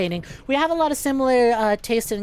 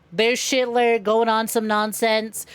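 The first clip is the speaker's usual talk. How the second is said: normal talking voice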